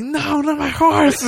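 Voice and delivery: high, slow laughing cry; mock wail, laughing. Man losing his horse, high laughing mock wail. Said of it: "he's just like".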